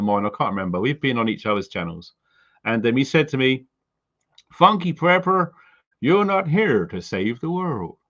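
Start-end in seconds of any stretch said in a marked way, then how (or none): 4.5-8.0 put-on American accent. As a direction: quote a mate in a rough American accent. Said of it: "in his American accent".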